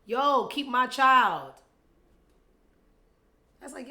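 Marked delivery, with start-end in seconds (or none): 0.0-1.5 loud, slow, drawn-out casual demand; mock casual demand. Mocking [name]'s casual text, loud and slow. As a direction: drag it out like a lazy casual order. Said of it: "so informal".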